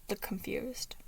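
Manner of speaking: ordinary delivery, nothing marked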